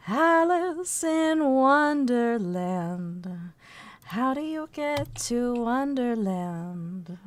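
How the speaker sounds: put-on slow, soft, dreamy little-girl voice; Alice in Wonderland. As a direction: drift the words out like a lost storybook girl. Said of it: "Mama looks more like Alice in Wonderland".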